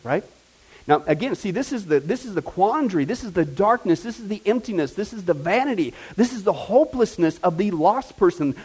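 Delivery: ordinary talk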